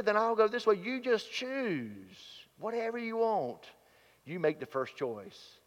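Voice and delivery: brotherly urging that fades to a gentle offer; Abram offering Lot the choice. Retold or imitated. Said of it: "He says".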